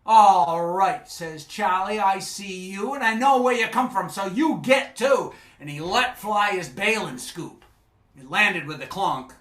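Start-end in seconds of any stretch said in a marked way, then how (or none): 0.1-5.3 much louder, fast rising shout; angry yell. Acted. Charlie yelling at the mermaid to leave, angry shout. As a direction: drive her off in a temper.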